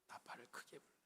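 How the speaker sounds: whispering 크게 as a playful contrast; joking whisper. Shown as a demonstration. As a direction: ironic whispered trumpet call.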